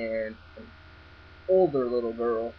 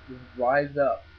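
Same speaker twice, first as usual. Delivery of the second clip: ordinary talk, nothing marked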